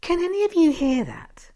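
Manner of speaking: high, loud urgent cut-in; quick interruption. Acted. Wife interrupts husband before he mentions the accident, high and loud. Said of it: "I interrupt quickly".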